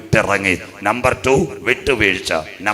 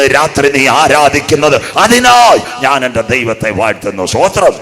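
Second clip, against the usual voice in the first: loud, raised revival-preacher delivery; full-force preaching; 3 semitones higher, 8 dB louder than usual. Real, not acted. Preacher promising God's protection to the congregation, full-force exhortation. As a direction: preach it out at full force, building to a shouted praise.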